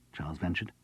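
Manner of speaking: plain reading voice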